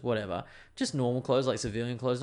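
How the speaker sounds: usual speaking voice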